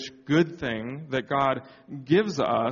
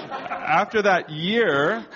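laughing; 7 semitones higher, 3 dB louder, much slower than usual. Real, not acted. I should not be smiling.

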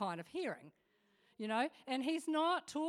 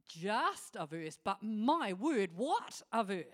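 slow, louder, building to "what a verse"; preacher marveling at one Bible verse; emphatic wonder; 4 dB louder, slower than usual. Acted. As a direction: savour it, then land the marvel.

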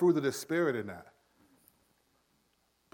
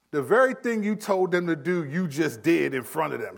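ordinary talk, nothing marked

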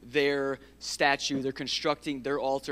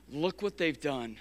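ordinary talk

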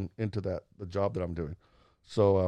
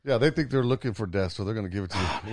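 ordinary delivery

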